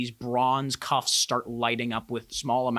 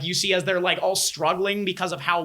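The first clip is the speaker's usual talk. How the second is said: ordinary talk, nothing marked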